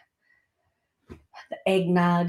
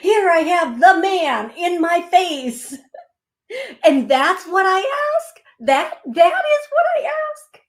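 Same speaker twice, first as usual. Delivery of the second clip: much higher, louder, incredulous through laughter; laughing disbelief; 10 semitones higher, 5 dB louder than usual. Real, not acted. Mocking her own question to Jesus, laughing disbelief. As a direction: marvel at your own silliness.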